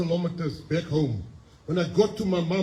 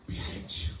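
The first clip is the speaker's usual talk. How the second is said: imitating his mother's hushed whisper; whispered warning; 6 dB quieter than usual. Mother warns him in retold dialogue.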